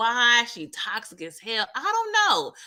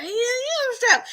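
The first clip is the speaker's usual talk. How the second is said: much higher, proud; hammed-up eager yes; lightly voiced cocky young man; mock tough eager boast; 13 semitones higher than usual. Braden boasting he's armed.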